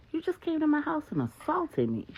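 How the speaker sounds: indignant man accusing his attacker; indignant Marcus. A narrator's reading. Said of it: "Marcus says".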